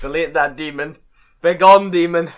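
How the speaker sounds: booming mock exorcism shout; mock exorcist. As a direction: cast it out like a priest. Mock banishing his old photos.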